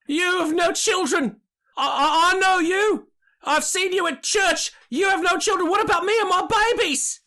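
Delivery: a mother pleading for her babies, near sobbing; desperate mother crying out. Acted. A mother in the crowd crying out at the speaker about her babies. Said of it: "And a female voice is".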